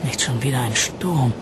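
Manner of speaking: soft, weary sigh. A narrator's reading. Arabella dreads yet another storm, sighing. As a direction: sigh the words with mild dismay. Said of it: "seufzte Arabella".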